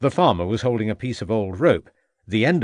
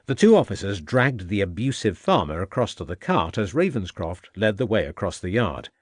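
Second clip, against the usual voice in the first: ordinary delivery, nothing marked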